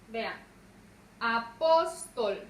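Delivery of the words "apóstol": a... PÓS... tol, punching the middle of "Apóstol"; stressed middle syllable. Shown as a demonstration. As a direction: hammer PÓS in "Apóstol", then fall away. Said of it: "Lleva su sílaba tónica en la".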